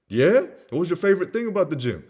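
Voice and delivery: loud, high, quick question; hyped, fast follow-up; eager salesperson. Acted. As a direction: jump on the answer with enthusiasm.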